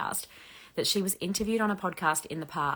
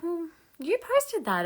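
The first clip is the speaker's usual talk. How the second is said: high, drawn-out mock protest; retold protest at being blocked; 12 semitones higher, 4 dB louder, slower than usual. Creator retells her reaction to being blocked, high-pitched protest. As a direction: protest it slowly, pitched well up.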